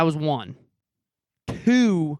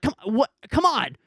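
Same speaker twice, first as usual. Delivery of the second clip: impatient; 7 semitones higher, 3 dB louder than usual. Retold or imitated. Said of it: "and I'm just like".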